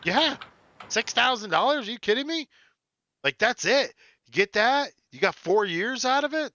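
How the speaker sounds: high, excited disbelief at the price; amazed disbelief. Real, not acted. Co-host amazed at the six thousand dollar price, high and excited.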